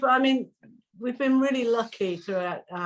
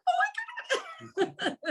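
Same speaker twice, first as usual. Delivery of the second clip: very high, slow fan squeal, laughing; starstruck, tongue-tied; 4 dB quieter, much slower than usual. Retold or imitated. Fan whenever her idol spoke to her, high giddy squeal. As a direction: stretch out a giddy mock squeal. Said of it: "I couldn't even speak properly".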